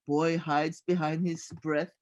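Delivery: usual speaking voice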